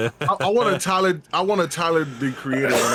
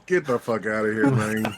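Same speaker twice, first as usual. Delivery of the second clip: imitating [name]; 8 semitones lower than usual. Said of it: "Rocky was like".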